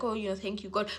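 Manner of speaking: offhand, soft, throwaway thank you; slight thanks. Shown as a demonstration. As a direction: mumble it like a passing habit. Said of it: "you don't put that emphasis in it".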